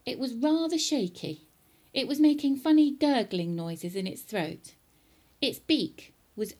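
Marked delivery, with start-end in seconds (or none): none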